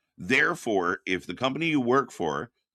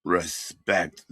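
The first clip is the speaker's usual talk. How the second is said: low, raspy mock wrestler growl; raspy deep voice; 6 semitones lower than usual. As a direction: rasp it out as deep as you can. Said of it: "the raspy voice kinda comes in here".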